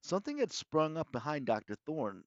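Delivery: plain reading voice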